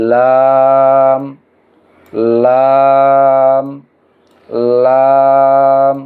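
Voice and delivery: লাম projected, the vowel held long and even; drawn-out recitation. Shown as a demonstration. Teacher leading learners in reading the letter, লাম drawn out long. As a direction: stretch লাম into one slow, level note. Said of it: "আমরা পড়ি".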